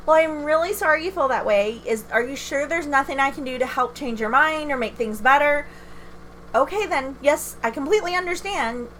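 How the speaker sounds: high-pitched customer service politeness; put-on smile in the voice; fake chipper. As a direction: stay sweetly polite to an abusive caller. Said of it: "it's that fake, chipper, let me smile voice".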